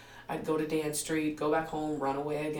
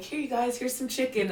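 offering chicken; 8 semitones higher than usual. Retold or imitated.

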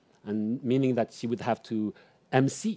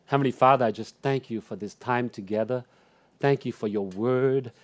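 earnest spoken prayer, rising in fervour; closing prayer; 3 semitones higher than usual. Acted. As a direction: pray over the congregation.

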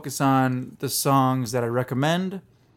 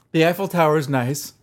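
normal talking voice

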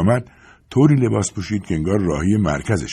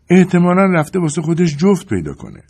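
Carla's higher voice, repeating her point; insisting again; 11 semitones higher, 5 dB louder than usual. A narrator's reading. Carla insists again to Clark about the goat. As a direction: stress it once more, a little tired. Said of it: "برای چندمین بار تاکید کرد".

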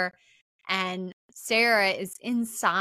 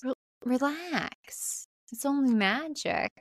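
mock soothing; 5 semitones higher than usual. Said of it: "And Sarah says".

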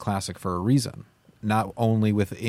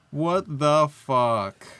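stunned disbelief; 5 semitones higher, much slower than usual. Real, not acted. Disbelief after lucky pull.

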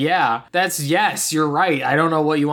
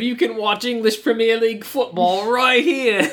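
lightly put-on British accent, pitched up; 5 semitones higher than usual. Podcaster mimics the movie villain, British accent. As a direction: play it as a smug British villain, British accent throughout. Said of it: "that was you doing Statham".

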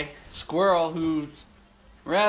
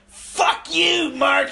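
much higher, loud mock yell; retold furious yell; 9 semitones higher, 4 dB louder, much slower than usual. Squirrel yelling at Mark after the knife flies, high furious yell. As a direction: scream it like a kid who lost his knife.

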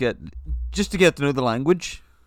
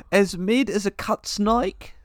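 put-on Australian accent; 7 semitones higher, slower than usual. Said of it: "say these in an Australian accent".

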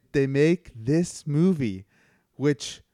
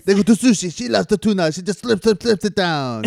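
mock French director; 6 semitones higher, 5 dB louder than usual. Riffing as the director.

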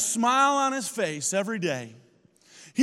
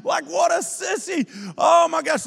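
put-on high-pitched, loud jeering kids; laughing, squealed schoolyard mockery; mocking laughter; 10 semitones higher, 5 dB louder than usual. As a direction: imitate kids cackling and taunting a crybaby. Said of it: "They're laughing at the kids that are crying".

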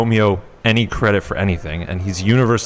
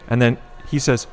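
ordinary talk

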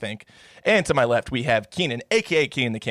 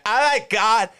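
much higher mock voice of tipsy young women; loud party girls; 9 semitones higher than usual. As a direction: squeal it like birthday girls showing off their age. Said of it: "shouting at the top of their lungs".

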